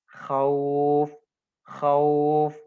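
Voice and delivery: khauf held on the au before a stop; mad lin demo. Shown as a demonstration. Teacher showing the held diphthong to students. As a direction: stretch khauf with a throaty kh. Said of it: "Makanya dia dibaca khauf".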